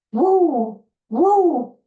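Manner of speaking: high, loud wrestler whoop; Ric Flair woo. Retold or imitated. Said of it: "that was his trademark".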